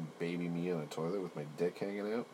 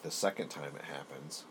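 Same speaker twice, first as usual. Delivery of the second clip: normal talking voice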